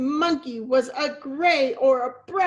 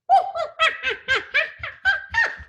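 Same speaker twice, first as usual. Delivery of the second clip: very high cheeky monkey laugh; 4 dB louder than usual. Acted. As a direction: tease him like a monkey.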